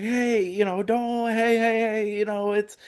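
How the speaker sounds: hammed-up retelling of someone cooling things down; imitating a peacemaker. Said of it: "I remember Hero being there".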